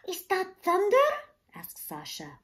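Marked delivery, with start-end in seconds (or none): 0.1-1.1 higher, louder startled question; girl startled by a rumble; surprised. Acted. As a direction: ask it in sudden alarm. Hearing a rumbling.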